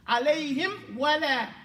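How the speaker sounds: Alayhim wala with the m pressed firmly shut; strong lip closure. Shown as a demonstration. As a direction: close the lips hard on the m of Alayhim. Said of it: "make it strong when you close it".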